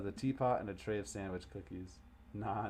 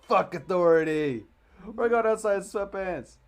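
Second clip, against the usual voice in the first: shouted full out, higher and much louder; rebel yell; 11 semitones higher, 13 dB louder than usual. Acted. Dad yelling defiance at his daughter, shouting. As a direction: bellow it like a teen rebel.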